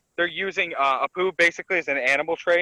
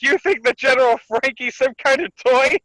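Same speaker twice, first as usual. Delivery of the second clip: indignant mock threat; 6 semitones higher, 4 dB louder than usual. Acted angry threat at Hacha.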